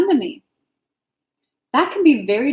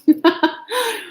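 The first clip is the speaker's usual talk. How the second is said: a bright, higher burst of laughter; real laugh; 9 semitones higher than usual. Real, not acted. Host laughs about eating a cookie live, amused laugh. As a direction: laugh at yourself mid-sentence.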